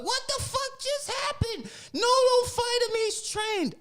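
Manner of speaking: very high, shrill-pitched loud mock panic; panicked wife. Wife bursting out the door, high panicked plea. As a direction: squeal it like a frantic wife.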